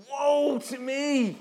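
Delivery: high, slow, stretched lament; anguished cry. Acted. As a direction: cry out in dread before God. Isaiah's cry before God.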